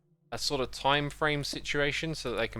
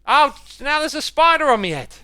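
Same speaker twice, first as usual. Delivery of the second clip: high panicked shriek; screaming at a spider; 12 semitones higher, 10 dB louder than usual. Real, not acted. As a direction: yelp in real alarm at the spider. Spider lands on his head.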